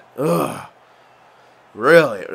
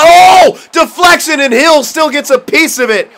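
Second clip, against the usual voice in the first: shouted much higher and louder play call; excited shout; 12 semitones higher, 8 dB louder, slower than usual. Real, not acted. Gamer calling a deflected shot on his own game, excited shout. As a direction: yell it like the shot just went in.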